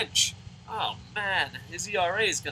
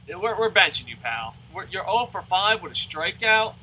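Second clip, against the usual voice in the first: usual speaking voice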